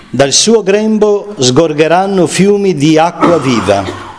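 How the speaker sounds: slow, lifted proclamation of the verse; gentle solemn proclamation; solemn scripture reading. Acted. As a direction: proclaim it like Jesus crying out. Proclaims Jesus' cry in Gospel.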